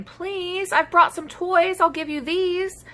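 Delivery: a lonely dino pleading with his classmates. Acted. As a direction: coax them gently with the toys. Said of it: "The Minosaur tried saying".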